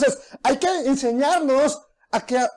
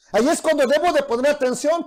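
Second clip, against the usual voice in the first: usual speaking voice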